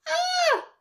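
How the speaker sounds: very high shocked scream; startled shriek. Acted. Walrus startled by the cub, high shriek. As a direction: shriek in fright.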